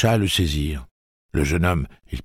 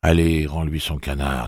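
usual speaking voice

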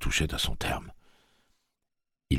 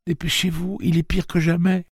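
petite voix pressante; 5 dB louder than usual. A narrator's reading. Petit Blaine presse encore.

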